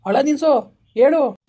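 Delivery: loud barked order; loud command. Acted. Exorcist shouting at the spirit to answer, loud bark. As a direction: bark it at the spirit to make it talk.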